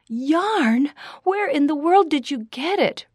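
high, loud amazed question; astonished. A narrator's reading. Daughter amazed by the yarn Papa holds, astonished. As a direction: marvel at an unexpected gift. Delighted surprise at gift.

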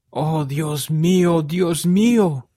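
slow, high wail, swelling on the second cry; anguished moan. A narrator's reading. José learning the manuscript is gone, anguished moan. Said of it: "gimió José".

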